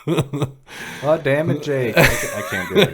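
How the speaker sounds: put-on half-hearted Boston accent; Boston accent attempt. Player attempting the Boston accent rule, in a shaky attempt at the accent. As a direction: try a mock Boston accent. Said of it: "speaking in a boxing accent".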